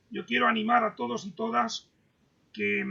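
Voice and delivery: ordinary delivery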